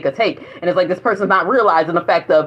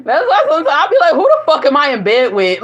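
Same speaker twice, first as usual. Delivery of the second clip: laughing; 8 semitones higher, 4 dB louder than usual. Real, not acted. Laughing disbelief at partner.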